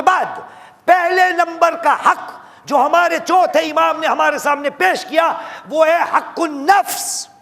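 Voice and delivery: high, loud, rising sermon cry; fiery pulpit delivery; preaching at full force. Acted. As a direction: drive the point home to the congregation. Preacher building to the main point.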